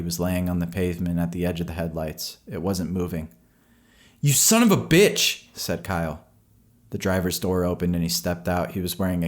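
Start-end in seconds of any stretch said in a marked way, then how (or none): 4.2-5.4 high, loud furious shout; furious yell. A narrator's reading. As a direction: yell at the driver who hit the dog. Boy yells at driver.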